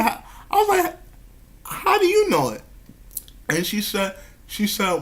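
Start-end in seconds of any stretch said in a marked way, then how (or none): 0.0-2.6 high, incredulous asking of how she knows; a son asking his spirit mother in disbelief; surprised question. Retold or imitated. Son asks his mother how she knows, high and incredulous.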